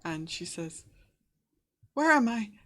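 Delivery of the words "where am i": put-on high, loud girl's voice in alarm; frightened, panicked girl. Game master voices a lost girl's spirit, afraid and panicked. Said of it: "she sounds, uh, quite afraid and quite panicked".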